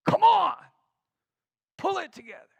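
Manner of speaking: sharp, loud, high burst; exasperated scolding. Shown as a demonstration. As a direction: snap at a kid to shape up. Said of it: "Same words, different communication".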